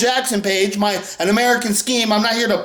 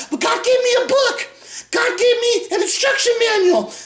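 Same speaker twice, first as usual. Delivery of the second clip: very high, whiny mock voice; hammed-up high-pitched imitation; mocking believer; 10 semitones higher than usual. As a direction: parody a naive believer in a squeaky pitch. Mocking believers in an acted reply.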